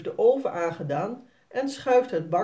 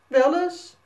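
put-on higher, louder stubborn kid; koppig kind; 9 semitones higher, 7 dB louder than usual. A narrator's reading. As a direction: fire it back like a stubborn child. Kinderruzie, Jasper kaatst terug. (hoger en luider koppig kind)

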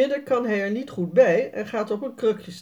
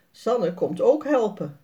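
ordinary delivery

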